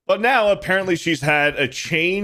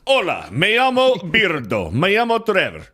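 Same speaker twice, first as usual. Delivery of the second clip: mock Spanish accent; 6 semitones higher than usual. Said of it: "you speak with a Spanish accent".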